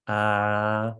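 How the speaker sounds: open-mouthed "Ah", deep; dentist-style "Ah", low. Shown as a demonstration. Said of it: "And then you say".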